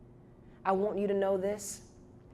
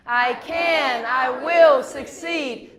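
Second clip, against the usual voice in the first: loud, slow rallying shout; a bit louder; 6 dB louder, much slower than usual. Shown as a demonstration. Speaker pushes the audience to repeat it, louder chant. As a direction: shout it out with the crowd, words stretched. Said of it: "this time we should get a little bit louder".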